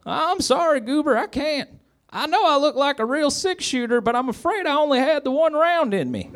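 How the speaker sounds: very high, squeaky pleading; Barney pitiful. A narrator's reading. Barney looking up at Goober, pitiful high squeak. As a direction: apologize as a pitiful squeaky deputy. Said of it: "looked pitifully up at Goober".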